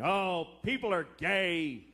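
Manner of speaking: drawn-out, wailing mock complaint; mock outrage. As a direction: stretch it into an exaggerated anguished moan. Mocking homophobes fretting at home.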